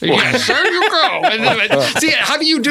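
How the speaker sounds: high, breathy Connery impression; asthmatic Sean Connery. Shown as a demonstration. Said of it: "you gotta have asthma and do Sean Connery".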